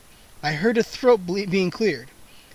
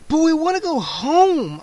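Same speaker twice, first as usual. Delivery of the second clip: much higher, loud desperate scream; 10 semitones higher, 5 dB louder than usual. A narrator's reading. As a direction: cry out for home. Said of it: "I screamed".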